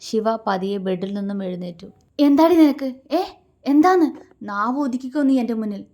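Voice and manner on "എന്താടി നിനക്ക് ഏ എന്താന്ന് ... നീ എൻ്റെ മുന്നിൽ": much higher and louder furious shout; raised voice. A narrator's reading. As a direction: roar it at her. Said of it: "Shiva അവളുടെ ശബ്ദമുയർത്തി".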